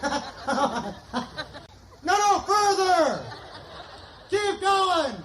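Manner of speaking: high, drawn-out call down the street; calling out far. Retold or imitated. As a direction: stretch it out to reach someone far off. Calling brother down the block.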